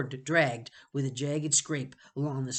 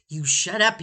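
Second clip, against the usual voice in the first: louder, cracking with anger; 5 semitones higher, 9 dB louder than usual. Acted. Jonathan silencing Benny, voice cracking. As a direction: force it out as the voice breaks. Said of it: "his voice cracking".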